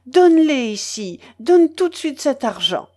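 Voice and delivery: loud, commanding order; snatch the money back, loudly; a fierce loud demand. A narrator's reading.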